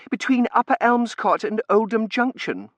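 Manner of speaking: put-on high, loud girlish voice; excitable daughter. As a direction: answer in a high, agitated voice.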